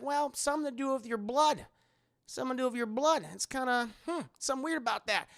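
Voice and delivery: high, sing-song fake puzzlement; imitated coy examiner; mock doctor musing. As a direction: muse in a put-on lilting voice. Acting David examining her.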